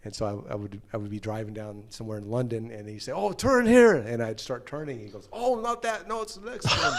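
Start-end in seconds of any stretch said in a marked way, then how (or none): 3.1-4.0 high, louder mock navigator call; urgent backseat directions. Alan giving directions back to the hotel, urgent high call. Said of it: "trying to give me directions". 5.3-6.9 high-pitched mock navigator correcting the driver; backseat correction. Alan correcting the driver's turn, high flustered correction. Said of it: "trying to give me directions".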